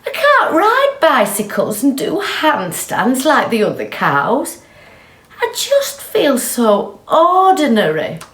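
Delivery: high, mopey lament; a lightly voiced sad cow; glum cow. Marjorie voiced in story.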